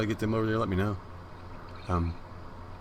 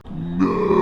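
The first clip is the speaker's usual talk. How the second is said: loud, hammed-up cry of grief; dramatic despairing no; 6 semitones lower, 6 dB louder than usual. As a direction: cry out in mock-tragic despair. Retold scream as cat dies.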